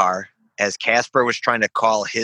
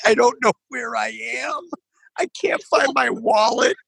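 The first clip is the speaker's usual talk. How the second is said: much higher, yelling in confused panic; panicked cry; 13 semitones higher than usual. Retold or imitated. Lost and frantic, voice shooting up high. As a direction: veteran retelling waking up lost and walletless, panicked high yell.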